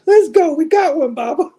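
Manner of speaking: high-pitched hyped cheer; excited cheering. Real, not acted. Cheering a character announcement.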